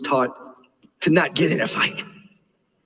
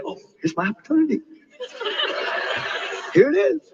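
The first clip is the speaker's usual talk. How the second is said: gleeful; 12 semitones higher, faster than usual. Retold or imitated. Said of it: "and he said".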